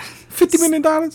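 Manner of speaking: high squeaky disbelief; squeaky incredulous. Pretend. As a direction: squeal it in mock shock. Mock outraged quoting.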